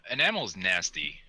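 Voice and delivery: usual speaking voice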